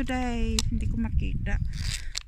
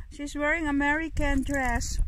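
ordinary delivery

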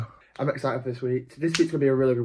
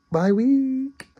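high sing-song; 12 semitones higher than usual. Acted. Sing-song vlog intro.

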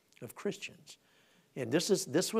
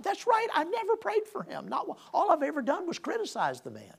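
high-pitched, stricken admission; a much higher voice, owning his guilt; sudden realization; 12 semitones higher than usual. Retold or imitated. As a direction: jump way up, suddenly convicted. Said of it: "and I'm like".

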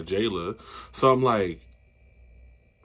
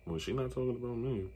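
much softer imitated aside; quiet aside; 12 dB quieter than usual. Imitating castmates who stay out of it, hushed aside. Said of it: "Taseki and Sky was like".

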